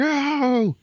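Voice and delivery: high, loud cry of pain; mock howl of agony; pained yelp. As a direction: yelp as if your leg just broke. It really fucking hurts.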